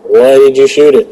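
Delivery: loud, high reproach; scolding game. Retold or imitated. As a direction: scold the player. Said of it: "That's basically what it's telling me".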